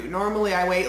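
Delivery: usual speaking voice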